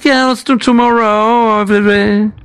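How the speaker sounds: lightly put-on French-accented English, hesitant; prank caller. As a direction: play a clumsy foreign caller, stumbling through English. Prank call in broken English.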